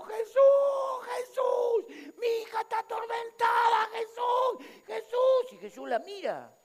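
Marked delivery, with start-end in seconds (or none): 0.0-5.5 very high, loud cry for help; desperate shouting. Retold or imitated. Mother begging Jesus for her tormented daughter, desperate shouting. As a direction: shriek it out, pleading to be heard. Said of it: "Porque viene gritando".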